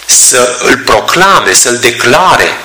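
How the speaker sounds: drawn out word by word, very slowly; slow, weighty emphasis. Acted. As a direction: let each verb land slowly and heavily. Preacher stressing the disciples' proclamation.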